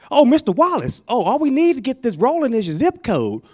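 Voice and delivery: high-pitched, eager phone agent; 電話オペレーター役. Acted. Phone agent greets the caller.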